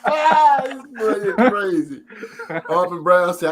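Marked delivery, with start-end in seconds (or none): 0.0-2.0 loud, high, slow words through laughter; laughing while talking. Real, not acted. Host on friend's fart joke, genuinely laughing. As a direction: get the words out while cracking up.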